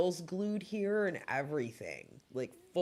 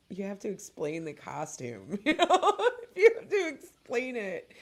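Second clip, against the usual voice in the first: laughing; 6 semitones higher, 4 dB louder than usual. Retold or imitated. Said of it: "I was like".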